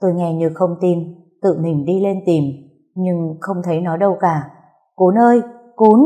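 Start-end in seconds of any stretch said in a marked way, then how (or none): 5.0-6.0 put-on raised, higher call for a missing child. A narrator's reading. Mother calling for her missing daughter upstairs, calling out. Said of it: "Tôi gọi mãi không thấy con thưa".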